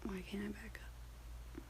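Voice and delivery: a slow, almost silent whisper; whispered to herself. Real, not acted. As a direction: breathe the question to yourself in frustration. Frustrated with calendar app.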